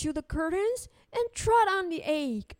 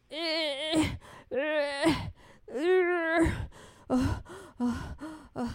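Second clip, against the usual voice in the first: grunting effort of pushing; straining grunts; much slower than usual. Acted. Old lady pushing the cow out, straining. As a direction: heave with each uh.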